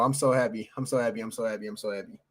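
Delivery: panting and fading away, out of breath; breathless after dancing, trailing off. Real, not acted. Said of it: "I'm out of breath".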